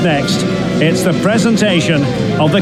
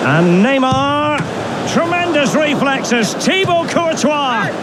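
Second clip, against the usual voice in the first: raised-pitch commentary with drawn-out names; excited save call; 5 semitones higher, slower than usual. Acted.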